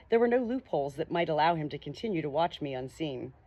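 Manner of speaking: plain reading voice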